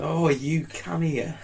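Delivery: slow, high EastEnders-like accent with laughter; Pompey accent. Shown as a demonstration. Portsmouth native demonstrates his slang, slow Pompey accent. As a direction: draw it out in broad Pompey. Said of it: "It's almost like EastEnder".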